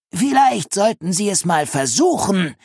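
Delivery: high-pitched, spiteful neighbour; venomous. A narrator's reading. Neighbour scolding Linus over his uncontrolled cat, venomous. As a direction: needle him in a high, acid voice. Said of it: "giftete Misses Clapper".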